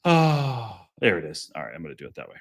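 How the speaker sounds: ordinary delivery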